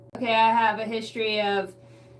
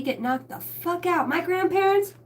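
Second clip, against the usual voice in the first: ordinary talk, nothing marked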